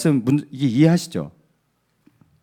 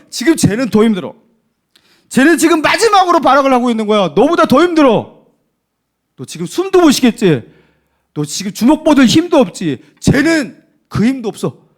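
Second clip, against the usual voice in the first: higher, louder urgent coaching; coach's shout; 5 semitones higher, 5 dB louder than usual. Retold or imitated. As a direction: push the tired boxer on, loud and high. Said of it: "이렇게 막 격려를 하면서".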